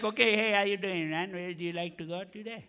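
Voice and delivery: hint of a cool Indian dude's accent. Cab driver greeting his passenger, Indian accent. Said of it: "got that accent".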